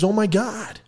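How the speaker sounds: high-pitched stunned exclamation; mock shock. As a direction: react as the astonished captain. Captain shocked.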